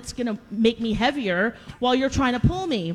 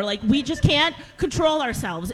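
ordinary delivery

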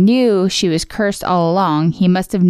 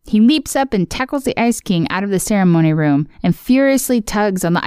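ordinary delivery

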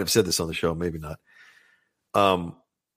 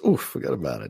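rueful mobster sigh; 6 semitones lower than usual. Shown as a demonstration. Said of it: "Sometimes it means".

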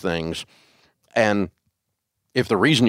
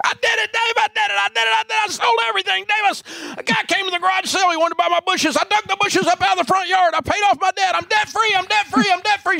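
imitated ecstatic yelling. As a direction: mimic her rapid-fire, high-pitched shouting at full tilt. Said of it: "she was yelling at the top of her lungs".

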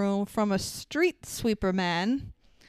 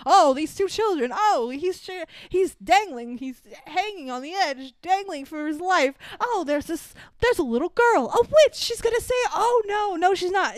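high, hammed-up live commentary; mock on-the-scene reporter, swooping with alarm; breathless news reporter; 8 semitones higher than usual. Reporter narrating children dangling in the air, hammed-up panic. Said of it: "news reporter standing there just, like, narrating".

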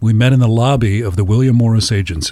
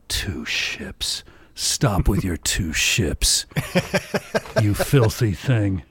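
"Two ships?" whispered, then slow and hushed; whispered inner thought; 3 semitones lower, 4 dB quieter, much slower than usual. Retold or imitated. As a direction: mutter it under the breath, drawn out.

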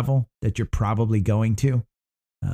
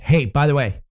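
ordinary talk

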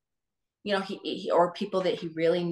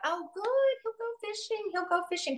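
much higher, squeaky hopeful voice; mock excited mom; 3 dB quieter than usual. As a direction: imitate mom's giddy relief, pitched way up. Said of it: "my mom was really excited".

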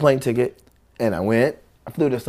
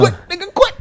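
very high, squeaky mimicry; mock urging; 6 dB louder, faster than usual. Friends and family urging him to quit, high squeal. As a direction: urge it in short high squeaks.

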